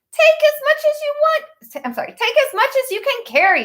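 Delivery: very high, light seahorse voice. A narrator's reading. Seahorse offering seaweed to the fish, high and friendly. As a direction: squeak it out like a tiny friendly seahorse. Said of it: "said the seahorse".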